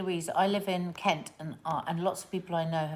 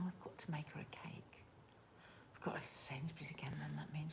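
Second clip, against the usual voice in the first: whispering; 18 dB quieter than usual. Real, not acted. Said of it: "Why are you whispering so quietly?".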